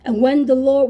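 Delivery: normal talking voice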